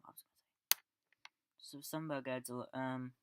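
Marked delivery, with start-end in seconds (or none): 0.0-0.8 the same question whispered to himself. Real, not acted. Reviewer muttering to himself mid-thought, whispered. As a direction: drop to a breathy whisper while searching your memory. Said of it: "I was trying to remember what I was gonna say".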